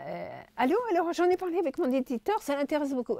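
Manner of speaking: high fast excited news; high phone voice. Retold or imitated. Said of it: "Elle avait une voix un peu aiguë". (voix aiguë au téléphone)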